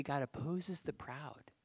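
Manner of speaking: ordinary talk, nothing marked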